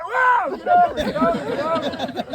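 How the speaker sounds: high, loud alarmed shouting; panicked yell. Retold or imitated. Mike yelling at the driver near the guardrail, panicked shout. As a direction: yell at the driver to steer away from the edge.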